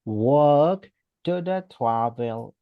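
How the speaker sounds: Walk to the travel agency, slow and deliberate; teacher modelling the answer; slow model sentence. Shown as a demonstration. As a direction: lay out each word slowly. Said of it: "Entonces ese sería".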